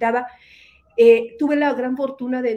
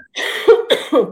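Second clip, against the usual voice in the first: sharp sudden cough; a hard cough; 4 semitones higher, 8 dB louder than usual. Real, not acted. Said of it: "Perdón, Ivoncita".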